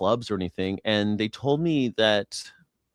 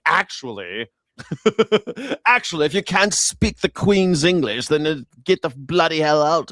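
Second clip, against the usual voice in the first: put-on posh English accent; 5 semitones higher, 4 dB louder than usual. Imitating a snobbish English person.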